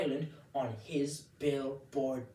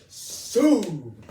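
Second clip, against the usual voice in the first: loud, long drawn-out comic wail; dramatic outcry; 7 semitones higher, 14 dB louder than usual. Acted. Comedian tells the crowd about being sued, dramatic outcry. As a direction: stretch the word out in loud mock horror.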